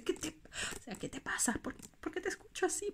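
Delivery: voicing her mother's startled concern; worried mom. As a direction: sound like a mom frightened by a strange voice. Quoting worried mom on phone.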